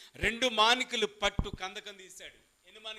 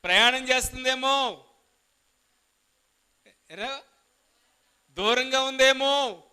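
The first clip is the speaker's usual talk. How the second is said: 1 mocking taunt; 5 semitones higher, 7 dB louder, slower than usual. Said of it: "ఏమంటున్నాడు".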